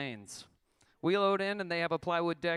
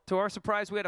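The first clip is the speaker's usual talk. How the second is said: loud; 6 semitones higher, 5 dB louder, faster than usual. Real, not acted. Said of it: "repeated louder".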